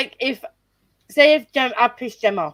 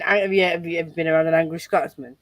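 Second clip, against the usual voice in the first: ordinary talk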